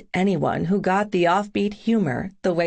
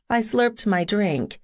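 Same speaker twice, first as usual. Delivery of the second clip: usual speaking voice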